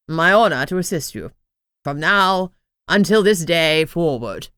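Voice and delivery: solemn crown. A narrator's reading. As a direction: pledge loyalty like a courtly knight. Crown swears service.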